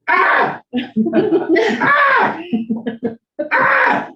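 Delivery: sudden high, loud yells of fake rage; mock angry yell. Shown as a demonstration. Demoing for Tom how he gets mad, loud yelling. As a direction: explode with staged anger at the mad stick. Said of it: "I get mad".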